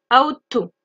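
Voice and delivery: ought to said as "aut o", no g or h sound; teacher models ought to with only the t; ought to without g or h. Shown as a demonstration. French teacher showing students how to say ought to. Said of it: "on ne prononce pas le G et le H".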